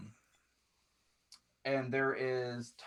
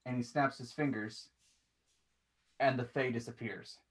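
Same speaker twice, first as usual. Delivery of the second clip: usual speaking voice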